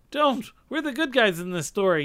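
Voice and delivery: lightly acted cry to hold fire; mild story yell. A narrator's reading. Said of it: "yelled Moonshadow".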